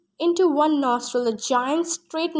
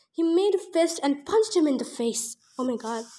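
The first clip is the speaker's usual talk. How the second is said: ordinary delivery, nothing marked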